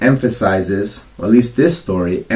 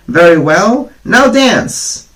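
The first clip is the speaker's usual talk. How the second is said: much higher, louder taunting cry; mocking shout; 10 semitones higher, 6 dB louder, slower than usual. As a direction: fling the taunt at him. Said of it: "they cried".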